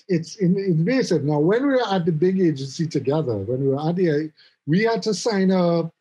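ordinary delivery

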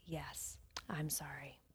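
very soft, low imitation of a stern dismissal. She answers him as she sends him away, stern and very quiet. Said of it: "stern face".

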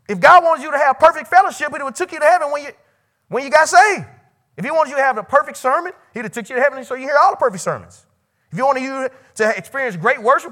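loud, high impassioned preaching; full-force preaching. Real, not acted. Preacher pleading with students, loud preaching. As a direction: preach it hard to the room.

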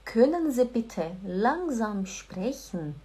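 slow, careful German sentence; slow, polite German. Shown as a demonstration. Teacher models the polite request slowly and carefully. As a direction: spell out every word slowly. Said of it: "Por favor, pessoal, anota".

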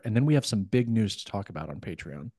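usual speaking voice

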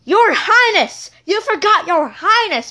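high, loud, indignant "Your Highness"; bossy demand. Acted. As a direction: insist on the title like a spoiled queen. Bossy queen demands her title.

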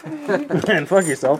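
laughing through it; giggling out the words. Real, not acted. Laughing while signing off.

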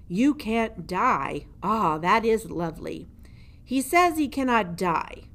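mocking old jailer. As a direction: savour the mouse's claim with scorn. Gregory mocks the mouse.

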